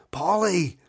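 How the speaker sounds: alarmed cry. Acted. Father cries out.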